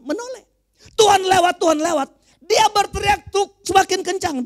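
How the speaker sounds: high, loud pulpit proclamation; full-force preaching. Real, not acted. Preacher telling how the beggar kept shouting, full-force preaching.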